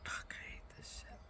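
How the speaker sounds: cursing in a whisper to herself; whispered curse about bearing bad news; exasperated whisper. Real, not acted. Cursing under breath, weary.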